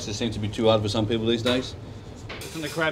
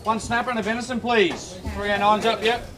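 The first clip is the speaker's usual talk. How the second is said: kitchen order callouts; 8 semitones higher than usual. Real, not acted. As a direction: call the order to the line. Chef calling orders.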